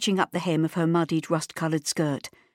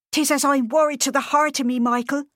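high, pleading, louder worried wife; worried Irish mother; 9 semitones higher, 4 dB louder than usual. A narrator's reading. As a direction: beg him anxiously. Mammy pleads with husband.

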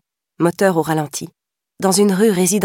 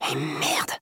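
softer muttered curse; 3 semitones lower, 8 dB quieter than usual. A narrator's reading. Wilson muttering a curse getting out of the car. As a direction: grumble it under the breath. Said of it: "marmonna Wilson".

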